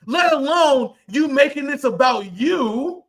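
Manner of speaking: normal talking voice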